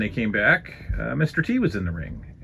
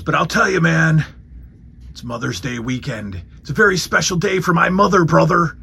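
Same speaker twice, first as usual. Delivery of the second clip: loud, higher hyped promo voice; Hulk Hogan impression; 6 semitones higher, 4 dB louder than usual. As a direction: sell it like a cheesy wrestler promo. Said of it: "then he goes".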